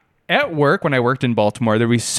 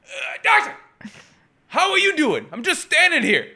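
put-on whispered then high strained old man voice; high fake-casual old man; old husband faking. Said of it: "he's like".

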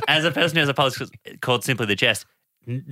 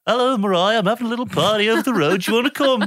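high, fast, breezy invite; 10 semitones higher, faster than usual. Retold or imitated. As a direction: chirp the invitation lightly. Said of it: "[name] ... was like".

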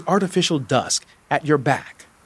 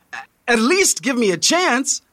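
high-pitched, stammered plea; pleading; 9 semitones higher than usual. A narrator's reading. Guide begs the tourist who turns to go, pleading. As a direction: beg with a catch at the start. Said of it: "you plead".

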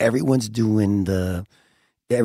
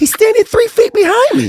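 incredulous exclaim; 6 dB louder than usual. Retold or imitated. Retold incredulous outburst.

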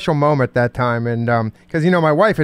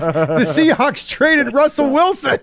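much higher, slow mock-wailing teen voice; upset son; 11 semitones higher, much slower than usual. Dad imitating his son's sad text, slow high mock lament. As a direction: stretch it out like a heartbroken kid. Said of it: "he's like".

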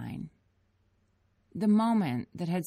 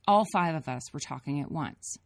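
ordinary delivery

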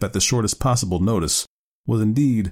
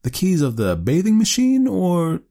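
higher-pitched feigned innocence; innocent teasing; 11 semitones higher, 3 dB louder than usual. A narrator's reading. Nephew feigning stupidity to tease his aunt, innocently. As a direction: ask it high, playing innocent. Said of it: "he asked innocently".

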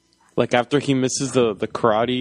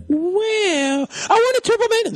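very high, put-on child voice; mock a kid excitedly making a wish; imitated eager kid; slower than usual. Co-host as Jamie asking for the toy, squeaky mock-eager kid. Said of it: "and then he's like".

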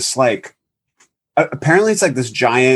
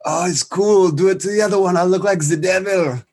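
high-pitched put-on boyfriend voice; imitated French boyfriend; 8 semitones higher than usual. Injured skinhead answering an apology, high put-on voice. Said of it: "And he says".

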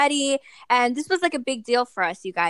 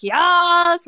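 excited cheer; 8 semitones higher, 6 dB louder than usual. Retold or imitated. Girls cheering about nomination.

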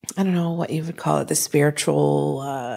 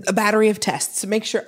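ordinary talk

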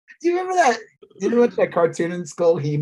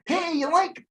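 fan quiz; 12 semitones higher than usual. Retold or imitated. Voicing fan quiz dialogue.

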